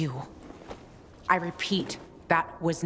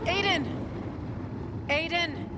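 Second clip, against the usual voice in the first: calling out; 12 semitones higher than usual. Acted. Searching rubble for lost friend.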